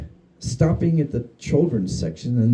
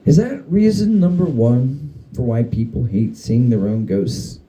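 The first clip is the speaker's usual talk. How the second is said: ordinary delivery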